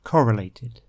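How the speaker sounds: "correlated" with the stress on COR; stress on first syllable. Shown as a demonstration. Teacher models the stress of "correlated" for learners. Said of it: "The stress for that word is on the first syllable".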